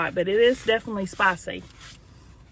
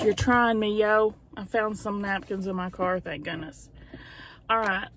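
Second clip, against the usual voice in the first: normal talking voice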